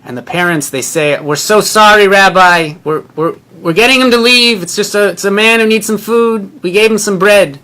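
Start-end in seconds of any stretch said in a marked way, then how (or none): 1.2-7.6 raised, high, hurried sorry to the rabbi; flustered apology. Retold or imitated.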